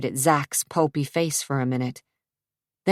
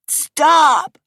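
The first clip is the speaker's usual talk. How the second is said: high, loud scream; desperate scream; 11 semitones higher, 8 dB louder than usual. Acted. Sophia screams at the thugs, high desperate scream. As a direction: scream to stop them.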